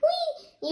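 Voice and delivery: high gleeful squeal; playful whee. Pretend. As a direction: squeal like running into the street. Kid mimics running into street.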